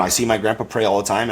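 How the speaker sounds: ordinary talk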